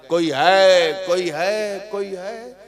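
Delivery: ordinary talk, nothing marked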